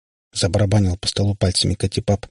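usual speaking voice

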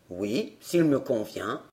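gruff showman. A narrator's reading. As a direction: growl it like a hoarse showman.

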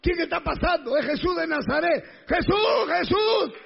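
high-pitched urgent calls; shouting. Acted. Blind man calling to Jesús passing by, shouting. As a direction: shout the name high, over and over. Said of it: "Gritó".

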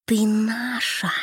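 eerie shadow voices whispering; ghostly whisper. A narrator's reading. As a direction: hiss like a chorus of spirits. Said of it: "их голоса сливались в один навязчивый шёпот".

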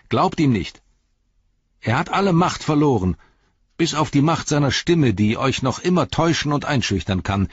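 high, loud firm authority; commanding. Acted. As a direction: forbid the killing firmly. Frodo commands the hobbits.

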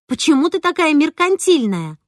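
выше и громче, с упрёком; бросить обвинение в лицо; обвиняя. A narrator's reading. Обвинение маме в споре. (дочь упрекает маму громко)

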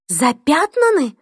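high, disbelieving echo; shocked outburst. Acted. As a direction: blurt it out in shock. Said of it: "не выдержала Хелен".

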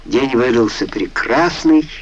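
plain reading voice

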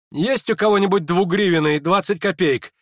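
high, loud, urgent request; sudden agitation. A narrator's reading. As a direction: burst out demanding a coin. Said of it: "вскочил вдруг Ипполит со стула".

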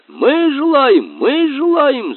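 громкие, очень высокие выкрики; прокричи хором поклонников, наперебой; крик восторженных кавалеров. A narrator's reading. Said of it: "закричали кавалеры". (восторженно крича)

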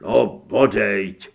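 low, slow, glum voice; gloomy agreement. A narrator's reading. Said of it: "souhlasil pan Šmicnutý zasmušile".

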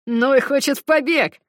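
loud high announcement; announcing. Acted. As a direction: proclaim it to everyone. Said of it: "объявил Шулер".

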